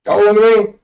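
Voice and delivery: ordinary talk, nothing marked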